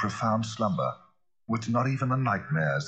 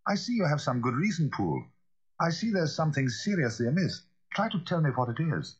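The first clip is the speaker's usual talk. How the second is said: higher-pitched, earnest gentleman; concerned lawyer; 9 semitones higher than usual. Acted. Lawyer presses Poole to say what is amiss, earnestly. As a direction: urge him gently to explain, pitch up.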